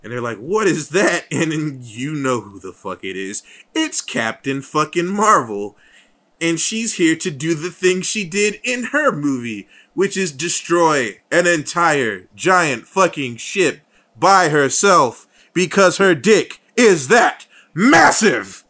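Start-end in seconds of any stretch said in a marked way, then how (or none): 0.4-1.2 higher, louder puzzled cry; mock startled question. Acts out soldiers' startled question. 3.8-18.6 slow, emphatic build to a loud peak; hyped proclamation. Real, not acted. Hyped announcement of a hero's arrival.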